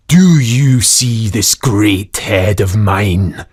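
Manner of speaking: slow, loud, looming giant ghost head; booming ghost voice. Acted. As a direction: boom it out slowly like a huge ghostly head. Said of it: "great, great voice".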